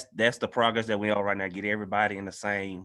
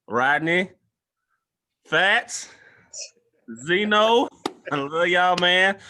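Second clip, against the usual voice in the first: loud, higher shout-outs, each name hit hard; calling out names; 8 semitones higher, 6 dB louder, much slower than usual. Real, not acted. Host closing the show, shouting out his brothers loudly. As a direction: call your brothers out by name across the room.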